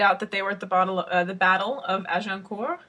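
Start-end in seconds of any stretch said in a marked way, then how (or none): 2.1-2.8 French accent. Shown as a demonstration. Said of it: "instructed to say in a French accent".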